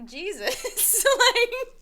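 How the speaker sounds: laughing so hard the voice squeaks up high; real wheezing laugh at the absurd story; helpless laughter. Real, not acted. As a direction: crack up, talking through the laugh. Laughing at the backstory.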